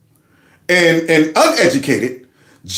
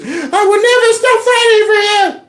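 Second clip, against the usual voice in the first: high falsetto mock pledge, laughing; mocking the politician; 13 semitones higher than usual. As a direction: ham up her teary vow much higher. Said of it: "here she is".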